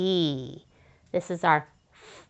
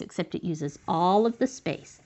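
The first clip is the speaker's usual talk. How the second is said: usual speaking voice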